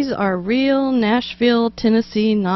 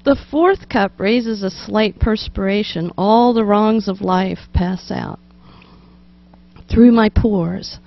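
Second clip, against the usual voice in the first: poem reading; 4 dB louder than usual. Acted.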